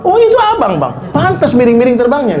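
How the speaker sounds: squeaky high "oh", then laughing; surprised wife. Acted. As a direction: realize it was him all along, amused. Wife's surprised reply.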